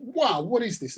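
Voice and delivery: bright, lifted gasp of wonder; wide-eyed delight at a gift; surprised. Acted. Farmer surprised by gift box.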